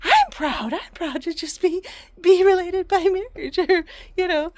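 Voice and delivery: high, loud, emphatic declaration; imitating proud dad. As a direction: proclaim it like an excited, proud husband. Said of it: "I'm like".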